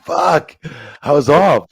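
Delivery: high, loud cry of disbelief; loud exasperated protest. Retold or imitated. Protesting to Jericho he was away, loud. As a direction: shout it, fed up.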